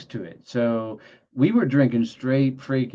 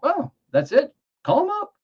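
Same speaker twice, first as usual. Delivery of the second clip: much higher, a hammed-up helpful housewife; mock lady voice. Homeowner lady telling him to call his boss, high mock voice. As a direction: squeak it out like the eager homeowner.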